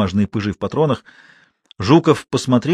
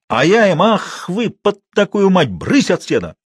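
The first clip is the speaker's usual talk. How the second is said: higher, lively mock scolding; scolding them away; 8 semitones higher, 3 dB louder, slower than usual. A narrator's reading. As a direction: shoo them off with a drawn-out curse. Матвей изображает, как гонит нечисть.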